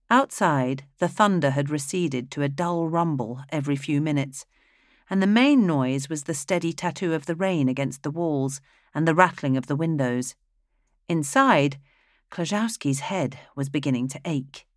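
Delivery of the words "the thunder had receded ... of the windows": ordinary delivery, nothing marked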